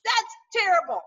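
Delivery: lightly voiced donkey objecting. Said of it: "Even the donkey's like".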